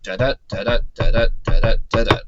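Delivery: da-DA beats tapped out, the second syllable of each pair stressed; demonstrating iambic stress pattern; iambic da-da rhythm. Shown as a demonstration. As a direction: count out the iambic feet aloud, light then strong. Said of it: "So you can count it out".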